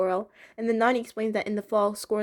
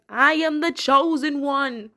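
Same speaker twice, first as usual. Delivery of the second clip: a mock-grand leaf, slow and a bit higher; grand proclamation; 5 semitones higher, 5 dB louder, slower than usual. Voicing a leaf in the cartoon as a mock-grand proclamation. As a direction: puff up like the chosen one, slowly. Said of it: "it's basically like that leaf saying".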